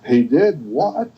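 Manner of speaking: slow, drawn-out disbelief; incredulous. Pretend. Adult hearing the bunny broke the lamp, slow incredulity. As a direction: stretch it out, astonished. Adult reacts to child's tall tale.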